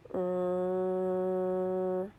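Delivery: "R" as one long held "rrr"; slow sound. Shown as a demonstration. Teacher models a sound for children, "R" held long. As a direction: hold the sound of "R" steadily. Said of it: "say a sound slowly".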